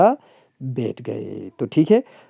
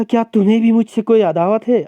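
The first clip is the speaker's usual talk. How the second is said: higher, louder indignant challenge; indignant Thakur; 8 semitones higher, 4 dB louder than usual. Acted. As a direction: rise up offended as the Thakur. Thakur voiced in story.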